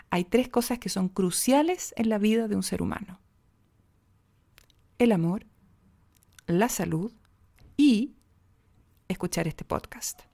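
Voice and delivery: long pauses before "el amor", "la salud" and "y"; suspenseful pauses. Shown as a demonstration. Podcast coach demonstrating pauses to the listeners, suspenseful pauses. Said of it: "las pausas cómo van generando ... un poco de suspenso".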